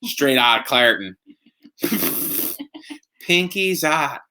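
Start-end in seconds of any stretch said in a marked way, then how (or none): 3.2-4.2 drawn-out Pittsburgh accent; slow Pittsburgh vowels, pitched up. Shown as a demonstration. Host showing off local slang to his wife, Pittsburgh accent. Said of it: "That's perfect Pittsburgh accent".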